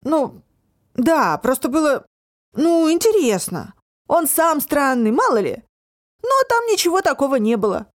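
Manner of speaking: put-on high, defensive teenage boy; young lad making excuses, voiced high.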